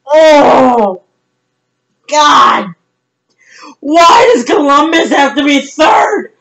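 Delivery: drawn-out wailing cries; anguished despair. Real, not acted. Fan lamenting the draft result, anguished despair. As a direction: wail each phrase, long gaps between them.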